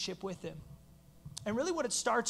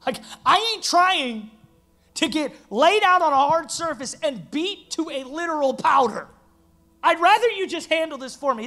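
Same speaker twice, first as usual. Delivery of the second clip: louder, higher, alarmed pleading as Peter; Peter protesting; 6 semitones higher, 9 dB louder than usual. Pretend. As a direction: protest as a panicked Peter who wants out. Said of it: "If I'm Peter, I'm like".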